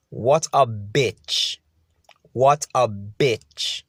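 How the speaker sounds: very slow, drawn-out contempt; slow, scornful verdict; bitter disgust. Real, not acted. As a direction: stretch every word in disbelief and disgust. Bitter verdict on cheating partner's non-apology.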